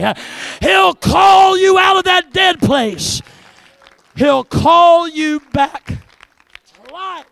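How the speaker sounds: loud, high, driving sermon cadence; full-force preaching. Real, not acted. Preacher to the congregation on Jairus hearing the voice, full-force preaching. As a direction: preach it hard in punched phrases.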